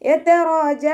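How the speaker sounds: high, slow chanted verse; recitation demo. Shown as a demonstration.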